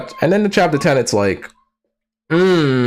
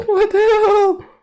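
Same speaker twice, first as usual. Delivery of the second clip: high, slow laughing what the hell; laughing disbelief; slower than usual. Real, not acted.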